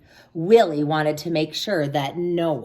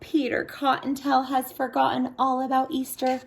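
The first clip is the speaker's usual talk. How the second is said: high small bunny voice; worried little bunny; 8 semitones higher than usual. Acted. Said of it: "cried the littlest bunny".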